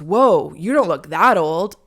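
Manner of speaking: mock the server's clumsy compliment; impressed server. Server reacting to her age, loud and surprised. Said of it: "he goes".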